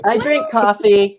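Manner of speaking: drink said loud and firm; I drink coffee, leaning hard on drink; stress on drink. Real, not acted. Correcting herself, author stresses drink over coffee. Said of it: "not, I don't drink".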